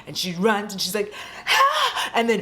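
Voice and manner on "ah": very high-pitched yelp; short, high acted cry; mock startled scream. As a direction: imitate a runner's sudden shriek. Acts the runner's cry on trap.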